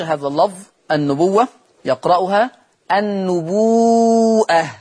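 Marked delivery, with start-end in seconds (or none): 2.9-4.7 النبوءة recited with a stretched و and a clear glottal stop; prolonged vowel. Shown as a demonstration. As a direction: draw out the و in النبوءة for six counts. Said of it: "فتمد الواو أيضًا مدا مشبعا".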